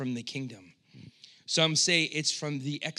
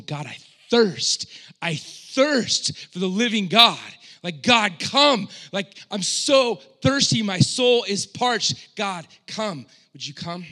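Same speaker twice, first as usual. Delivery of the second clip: rising, loud pleading cry, voice straining high; anguished cry to God; 6 semitones higher, 8 dB louder than usual. Retold or imitated. Preacher reliving his morning prayer, crying out desperately. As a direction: cry out to God as if dying of thirst. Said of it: "I found myself weeping this morning, just going".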